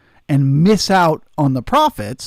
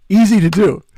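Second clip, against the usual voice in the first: higher and louder, hammering the word Easy; emphatic insistence; 5 semitones higher, 6 dB louder than usual. Real, not acted. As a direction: push it hard, like you're fed up repeating yourself. Coaching a frustrated trader friend.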